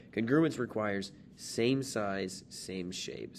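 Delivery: "Congruence requires same size" spoken slowly and deliberately; slow emphasis. Shown as a demonstration. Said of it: "teacher stating rule".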